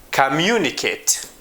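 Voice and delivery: "Communicate" with MU stressed, then a fall; "Communicate" as com-MU-ni-cate, said for learners; stress on middle syllable. Shown as a demonstration. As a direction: put the weight on MU in "Communicate". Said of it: "almost always in the middle".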